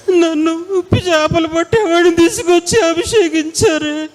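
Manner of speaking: high, crying lament, voice breaking into sobs; mournful weeping. Retold or imitated. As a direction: wail it like a broken man begging forgiveness. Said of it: "అని సంతాపపడి ఏడుస్తున్నాడంట". (ఏడుస్తూ విలపించడం)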